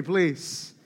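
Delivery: ordinary talk, nothing marked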